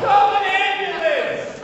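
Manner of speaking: slow, high, loud plea for help; panicked shout. Acted. Father begging bystander for an ambulance, shouted slowly. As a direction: stretch out a hammed-up desperate yell.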